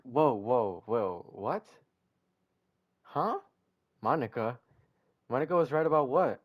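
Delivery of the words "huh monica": ordinary delivery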